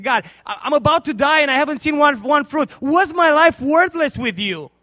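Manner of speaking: loud, high, slowing at the end; anguished preached prayer. Acted. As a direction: plead with God in anguish. Old man's despairing prayer.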